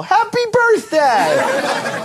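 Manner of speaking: high, slow, drawn-out happy birthday yell; yelled celebration. Retold or imitated. Firm yell after sex, drawn-out happy birthday. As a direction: belt it out like a party cheer. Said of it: "I always yell".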